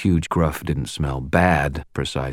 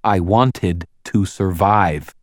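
louder, word by word, with pointed condescension; slow, insulting repeat; 4 semitones higher, 6 dB louder, slower than usual. A narrator's reading. Wizard repeating himself to the gruff, insultingly slow. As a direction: drag it out as if for an idiot. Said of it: "only more slowly, you know, so it would be all insulting".

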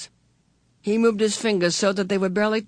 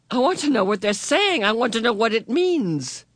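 eager; 5 semitones higher than usual. Acted. Meg insists desperately to know.

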